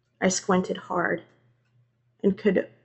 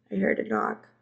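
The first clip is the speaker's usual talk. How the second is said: ordinary delivery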